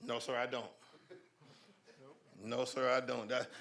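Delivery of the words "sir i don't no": normal talking voice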